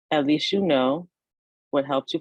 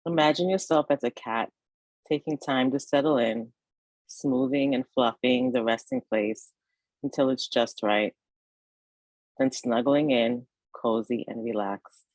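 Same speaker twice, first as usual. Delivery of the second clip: guided meditation; 2 semitones lower than usual. Acted. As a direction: ease the listener toward rest, phrase by unhurried phrase. Leads a yoga nidra meditation.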